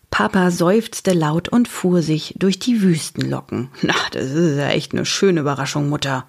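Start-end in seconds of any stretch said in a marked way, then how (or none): 3.8-6.3 low, weary sigh of a line. A narrator's reading. Papa to his mother after a surprise, weary. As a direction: drop the pitch, worn out. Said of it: "sagte er matt".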